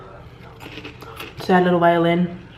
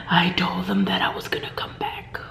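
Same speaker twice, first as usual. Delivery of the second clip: softer hushed movie-style secret vow; dramatic whisper; 6 dB quieter than usual. Retold or imitated. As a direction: whisper it like a tragic heroine. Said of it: "she even changed her voice".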